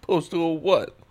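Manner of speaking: high put-on voice; mock quote. Follow-up quote in same voice.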